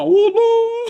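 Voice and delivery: very high cry breaking into laughter; laughing mock wail. Preacher imitates the pilgrim crying in prostration, laughing high mock wail. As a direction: mimic a wail, then laugh through the words.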